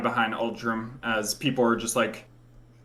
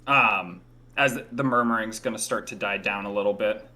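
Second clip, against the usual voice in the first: ordinary talk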